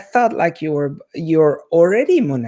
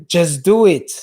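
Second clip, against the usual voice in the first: louder, punchy slogan delivery; slogan shout; 2 semitones higher, 8 dB louder than usual. Retold or imitated. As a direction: bark it like a Nike ad. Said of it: "I know he's going to be".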